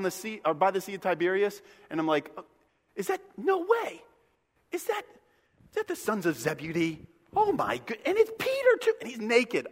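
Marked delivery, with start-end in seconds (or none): 2.4-9.7 high, incredulous squeaks; Jesus spotting his disciples, high and incredulous; mock disbelief. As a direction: act amazed at what you see. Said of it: "that's how I would act".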